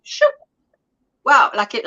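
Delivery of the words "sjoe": "sjoe" said as shoo, a quick exclamation like wow; Afrikaans sjoe. Shown as a demonstration. Host teaching chat the Afrikaans "sjoe", said shoo like wow. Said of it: "You say".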